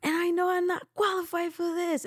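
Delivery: very high, breaking tearful plea; tearful confession. Retold or imitated. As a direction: confess it through tears. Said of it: "And I'm saying".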